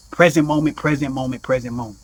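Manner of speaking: a dwindling repeated reminder; fading echo. Acted. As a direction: let the word fade away like an echo.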